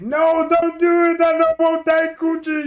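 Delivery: high, loud begging wail; hammed-up terrified suspect crying out; mock desperate plea from a tied-up suspect; panicked pleading.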